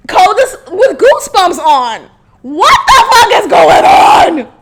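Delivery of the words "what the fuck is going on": loud, high, drawn-out disbelief; exasperated outburst. Retold or imitated. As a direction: cry out in mock outrage. Outburst at being left cold.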